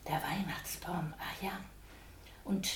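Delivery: breathy hushed whisper to himself; soft unvoiced aside. A narrator's reading. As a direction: whisper it as a private thought. Pelle's thought, whispered.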